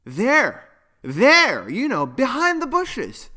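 high, excited pointing-out; exclaiming. A narrator's reading. Poppy shows Yuno the parents, high exclamation. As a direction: cry it out with rising urgency. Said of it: "exclaimed Poppy".

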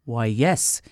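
low wizard's voice; low-pitched proud conjurer; knowing magician. A narrator's reading. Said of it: "said the magician".

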